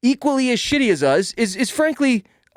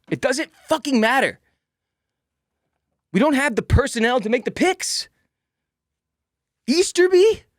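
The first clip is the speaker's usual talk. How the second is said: raised, heated rant about the team's draft picks; angry rant; 4 semitones higher than usual. Real, not acted. Fan ranting to listeners about the draft, angry. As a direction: vent real frustration, voice climbing. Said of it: "I'm angry".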